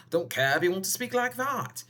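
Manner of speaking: playful funny voice, pitched somewhat up; second silly voice. Shown as a demonstration. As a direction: demonstrate another odd voice, a little high. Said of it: "weird yesterday with my voices".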